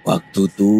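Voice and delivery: ordinary delivery, nothing marked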